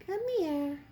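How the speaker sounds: high coaxing come here; high coaxing pet call. Real, not acted. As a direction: coax the cat over. Calling cat.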